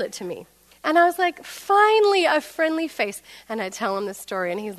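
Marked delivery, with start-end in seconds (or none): 1.4-3.2 relieved. Retold or imitated. Relieved to see the seller.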